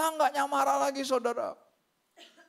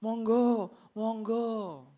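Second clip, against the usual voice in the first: mock-courteous Javanese invitation; put-on drawn-out courtesy; fake politeness; much slower than usual. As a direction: pretend to give way graciously. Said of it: "kita suka berpura-pura".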